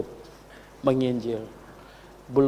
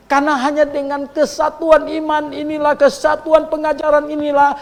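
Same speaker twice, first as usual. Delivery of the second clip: preaching at full force; 13 semitones higher, 10 dB louder than usual. Acted. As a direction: proclaim it to the whole congregation. Sermon climax. (khotbah lantang penuh semangat)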